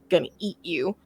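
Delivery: low, quiet imitation of dog menace; understated mock threat, pitched low; snarling dog. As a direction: lightly voice a growling dog. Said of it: "snarling, growling, and, like, staring at him".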